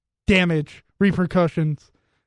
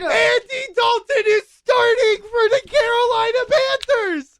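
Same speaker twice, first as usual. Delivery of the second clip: hyped announcer shout; 14 semitones higher, slower than usual. Pretend. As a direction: shout it like a miracle. Hype announcement of Dalton's return.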